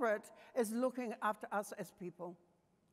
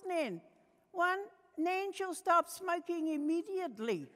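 put-on child's voice; 7 semitones higher, 3 dB louder than usual. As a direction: read out the rules like a proud little kid. Grandchildren read the articles.

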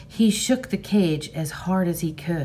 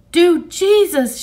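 high, loud, slow wild cry; wild scream; 12 semitones higher, 9 dB louder, slower than usual. Acted. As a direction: scream in panic at the gunshot. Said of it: "her voice high and wild".

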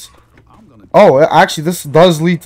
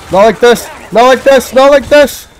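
higher, louder desperate plea; panicked cry; 10 semitones higher, 6 dB louder than usual. Real, not acted. Gamer pleading as the horde closes in, frantic.

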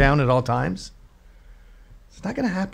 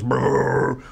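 motorcycle rumble; 4 dB quieter than usual. Retold or imitated. Motorcycle noise.

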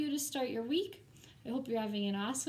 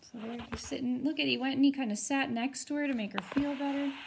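ordinary delivery, nothing marked

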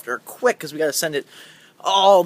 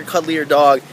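ordinary talk

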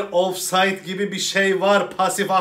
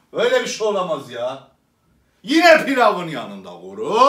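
ordinary delivery

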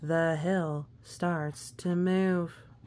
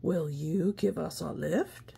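Sir Pete. Acted. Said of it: "says Sir Pete".

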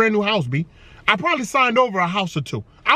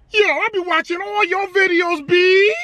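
imitating excited kid; 7 semitones higher than usual. Kid fan quoted excitedly.

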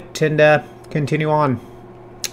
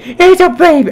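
put-on baby talk; 14 semitones higher, 5 dB louder than usual. Shown as a demonstration. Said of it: "I do that to my cats".

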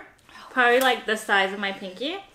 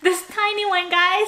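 high squeaky voice; 9 semitones higher, 3 dB louder than usual. Real, not acted. Showing tiny sliver, squeaky.

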